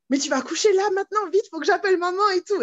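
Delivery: high, loud panicked rush; imitating the friend's panic; retold panic. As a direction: sound alarmed and hurried. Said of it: "elle est en panique".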